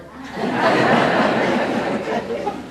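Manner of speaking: a big burst of breathy laughter; loud laugh. Real, not acted. Retelling Wallace's question to the audience, loud laugh. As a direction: crack up at the punchline.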